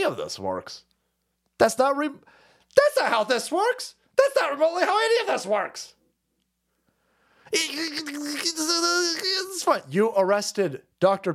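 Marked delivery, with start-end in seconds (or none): 1.6-5.9 much higher, whiny repeat of the phrase; high mocking whine, slowed then rushed; squeaky exasperated mockery. 7.5-9.8 high fake sob; squeaky mock-tearful "it's fine"; mock crying.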